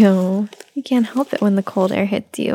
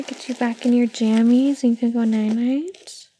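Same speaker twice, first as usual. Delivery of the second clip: ordinary talk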